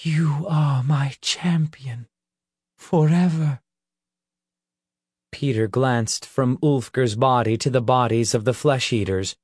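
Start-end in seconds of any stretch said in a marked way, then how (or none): 0.0-3.6 a hint of a gentle fairy queen; soft lady's voice. A narrator's reading. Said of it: "she whispered".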